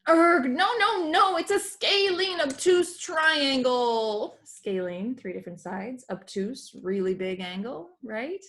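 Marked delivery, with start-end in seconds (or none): 0.1-4.4 high, loud and eager, slowing on the answer; excited kid shouting the answer; excited blurt. Acted. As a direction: burst in, sure you know it.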